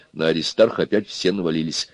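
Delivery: plain reading voice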